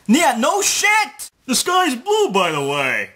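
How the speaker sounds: high, slow mocking yell; drawn-out sarcastic shout. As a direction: yell it like it's so obvious. Sarcastic rant at Joe.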